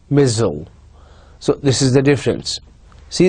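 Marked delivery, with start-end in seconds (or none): 0.1-0.7 American "missile" as "mizzle", with a short reduced second syllable; General American. Shown as a demonstration. Teacher modelling American "missile" for students.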